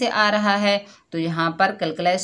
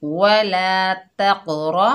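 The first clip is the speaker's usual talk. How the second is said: slow, drawn-out Quranic recitation; 4 dB louder, much slower than usual. Shown as a demonstration. Teacher reciting the word for pupils, slow chanted recitation. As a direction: recite it slowly, like tilawat. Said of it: "रॉ पुर पड़ी जाएगी".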